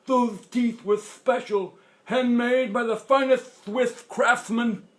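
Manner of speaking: put-on high, loud, slow old man voice; proud grandpa. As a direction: boast slowly as toothless grandpa.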